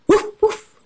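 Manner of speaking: high, little puppy woofs; playful high-pitched dog noise. Acted. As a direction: bark like a small dog in a storybook. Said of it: "said dog".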